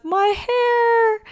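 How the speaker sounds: high, wailing mock sob over lost hair. Jo wails to her family about her hair, mock crying.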